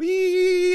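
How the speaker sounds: sudden very high squeaky toad call; toad call imitation. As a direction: squeal out a tiny toad's call. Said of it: "the American toads go".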